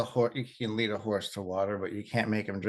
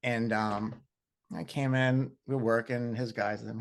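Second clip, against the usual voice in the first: ordinary delivery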